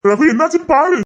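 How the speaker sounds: high, urgent cries for help; frantic shouts for help; panicked calling. Acted. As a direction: call her name in alarm. Calling out to fainted woman.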